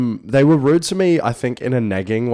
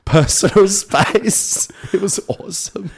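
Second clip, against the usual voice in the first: laughing; 11 semitones higher than usual. Real, not acted. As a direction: crack up mid-phrase. Retelling a funny story, laughing.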